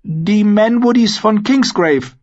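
high, slow, confident answer; growing confidence. A narrator's reading. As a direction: pronounce the name proudly and loudly. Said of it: "klang Pod zuversichtlicher".

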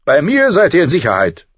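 prince's line pitched high and loud; high, loud, confident prince; voiced young prince. A narrator's reading. Prince promising Sansa she is safe, high and loud.

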